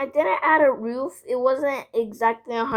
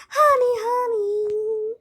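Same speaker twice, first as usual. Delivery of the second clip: high, slow syrupy honey; mock sweet honey; 10 semitones higher, slower than usual. Kid mocking her friend, high slow sugary honey. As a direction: coo it sarcastically.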